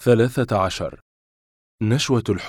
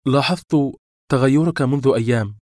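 higher, louder accusation; sharp; 5 semitones higher, 5 dB louder than usual. A narrator's reading. Woman confronting changed lover, sharply. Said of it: "فقالت بحدة".

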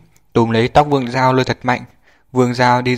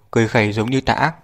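plain reading voice

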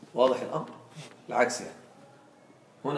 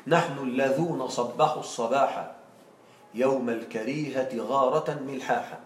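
slow, measured verse reading; teacher slowly reciting the poet's verse; poem recitation; 3 semitones lower than usual. Shown as a demonstration. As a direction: recite the line like classical poetry. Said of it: "وجاء عليها قول الشاعر".

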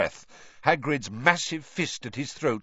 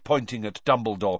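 plain reading voice

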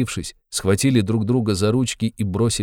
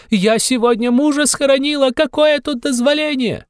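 desperate wail; 6 dB louder than usual. A narrator's reading. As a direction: scream it out in despair. Widow wails at official. (очень высокий отчаянный вопль)

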